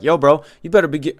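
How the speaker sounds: hammed-up New York guy demanding cash; New York accent. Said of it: "let me do a New York accent".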